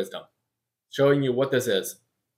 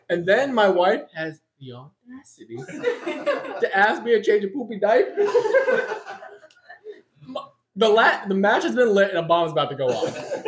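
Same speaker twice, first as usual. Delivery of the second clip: laughing; 5 semitones higher than usual. Real, not acted. Comic grievance, laughing.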